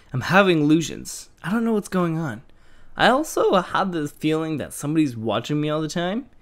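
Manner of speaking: eerie diary narration; dramatic diary reading. Acted. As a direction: read the diary like a creepy tale. Reads diary entry aloud.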